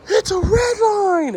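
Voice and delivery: high, loud cry of discovery; excited shout. Retold or imitated. Friend Carlos shouting as he spots the car, high excited yell. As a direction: yell it like you just spotted your dream car.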